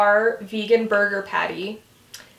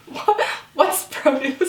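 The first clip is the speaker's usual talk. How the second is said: mock clueless Kelly; 3 semitones higher, slower than usual. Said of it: "And Kelly goes".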